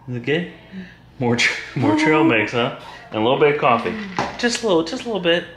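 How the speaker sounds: high, gentle sing-song questions; caregiver talk. Real, not acted. Talking to Abigail over her snack.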